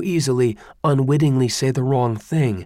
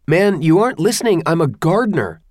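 frantic protest; 7 semitones higher, 5 dB louder, faster than usual. Acted.

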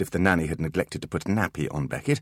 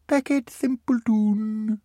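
put-on slow, high happy little-boy voice; happy toddler; much slower than usual. Beckett happily agrees, slow and high. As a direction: agree cheerfully like a small child. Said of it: "agreed the small boy happily".

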